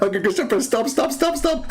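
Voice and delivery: high giggly pleading; squealing stop between laughs; laughing stop. Real, not acted. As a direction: beg him to stop while cracking up.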